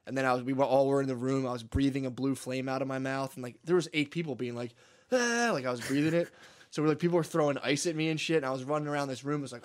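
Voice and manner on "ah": high mock "aah"; retold cry of alarm. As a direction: cry out like a startled crowd. Imitating people alarmed at blue flame.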